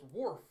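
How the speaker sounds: loud imitated dog woof; dog bark. Family dog answers the father, barking. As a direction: bark out one short woof. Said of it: "The dog just goes".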